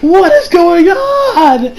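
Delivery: high, stretched wail of confusion; exasperated outcry. Retold or imitated. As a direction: cry out slowly in mock despair. Acting own bewilderment.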